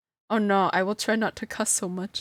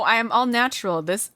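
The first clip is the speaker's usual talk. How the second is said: ordinary talk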